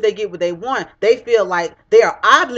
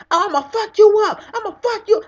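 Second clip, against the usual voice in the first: much higher-pitched imitation of someone mad; mock angry threat; 11 semitones higher than usual. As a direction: act out a hammed-up, high-voiced threat. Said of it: "you wanna get all mad".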